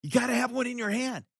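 loud excited burst. Real, not acted. Host stresses holding a Bible, loud and excited. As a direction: pitch it high and loud, like rallying a crowd.

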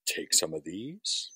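low and slow like a devil offering pills; a devil doctor, low and slow; sly devilish doctor. Retold or imitated. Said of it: "He says".

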